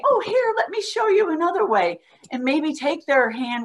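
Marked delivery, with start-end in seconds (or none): 0.0-2.0 much higher, kind teacher voice; sweet teacher redirect, brightly high; gentle redirect. Shown as a demonstration. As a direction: redirect the child encouragingly. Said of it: "So you would say".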